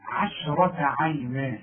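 عشرة عينا with thin عين and شين; correct slow reading. Shown as a demonstration. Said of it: "رقق لي العين". (نطق صحيح بطيء)

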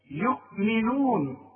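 slow, drawn-out يؤمنون with mi in the middle; clear "mi" syllable. Shown as a demonstration. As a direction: spell out يؤمنون slowly as yu'-mi-noon. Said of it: "الميم اوعى".

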